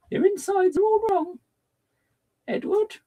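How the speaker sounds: very high, hammed-up shocked exclamation; theatrical quote. Streamer camping up the broken connections, theatrical shock. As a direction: deliver it like a horrified period drama lady. Quoting dramatic line.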